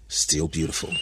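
ordinary delivery

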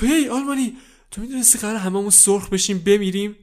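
high, loud urgent question; anxious calling out. Acted. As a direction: grab him and ask in alarm. Kid calls out to sausage.

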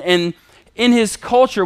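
ordinary talk

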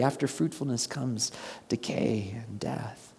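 slow whispered warning; grave whisper. Acted. Preacher reminding the blessed congregation, slow grave whisper. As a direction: whisper it like a solemn truth.

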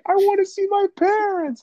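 put-on high whiny little kid voice; pleading kid voice. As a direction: beg like a scared child. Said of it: "one kid's gonna be like".

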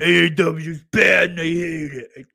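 loud, high mocking rant; mock complainer. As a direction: shout it like an exaggerated hater. Mocks a complaining wrestling critic.

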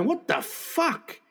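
high, drawn-out incredulous question; acted disbelief. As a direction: stretch it out in mock astonishment. Retold disbelief at sandals and socks.

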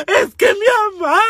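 sollozo agudo y alargado; llanto actuado. Retold or imitated. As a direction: llora exageradamente por mamá. Llora mientras el vecino consuela. (berrinche lloroso, lento y agudo)

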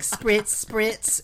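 high hissing spritz sound effect; spray sound. Retold or imitated. Imitating his spray bottle, a high hissing spritz. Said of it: "It was like".

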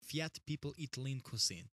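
slow, deliberate Russian accent; mock Russian, each word measured. Shown as a demonstration. Demonstrating for the co-host, slow Russian accent. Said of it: "I can do a Russian accent".

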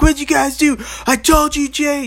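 high-pitched, loud panicked yelling; retold dad yelling. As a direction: imitate a freaked-out dad screaming. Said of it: "He started yelling at my brother".

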